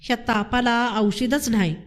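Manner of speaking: plain reading voice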